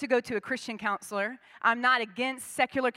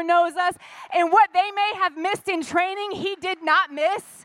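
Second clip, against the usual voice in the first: higher and louder, driving the point home; full-force preaching; 6 semitones higher, 6 dB louder than usual. Acted. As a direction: preach it out over the room.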